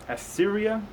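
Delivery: ordinary talk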